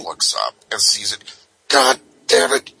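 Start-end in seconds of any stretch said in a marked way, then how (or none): 1.7-2.7 exasperated sergeant. Retold or imitated. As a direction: vent in frustration. Sergeant sees dildo, exasperated.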